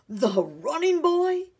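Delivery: high, loud, drawn-out amazement; astonished cry. A narrator's reading. Grandfather recognising the runner, astonished cry. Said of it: "cried the man".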